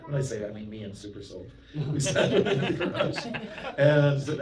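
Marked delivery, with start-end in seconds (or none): 1.9-4.4 words broken by laughter; laughing. Real, not acted. Monk recalling the garage visit, laughing.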